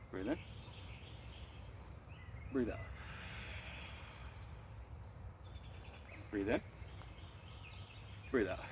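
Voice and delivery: soft, unhurried breathing count; guided breathing cues. Shown as a demonstration. Teacher leading students through qigong breaths, calm guided cues. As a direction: guide the class through each breath, gently.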